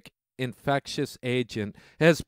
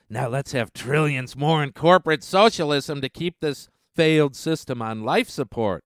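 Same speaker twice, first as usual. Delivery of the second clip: normal talking voice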